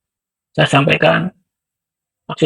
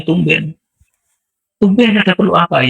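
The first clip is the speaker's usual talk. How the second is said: surprised woman; 7 semitones higher than usual. Retold or imitated. Woman repeats her surprise.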